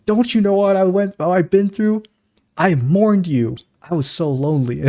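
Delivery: Trevor-style anguish. Retold or imitated. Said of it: "he says".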